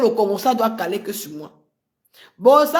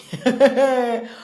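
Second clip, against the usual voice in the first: mocking laugh; 3 semitones higher than usual. Real, not acted. Laughing at the people of Le Havre, mocking laugh. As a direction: laugh it off, scornful. Laughs at her own line.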